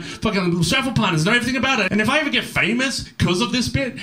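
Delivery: normal talking voice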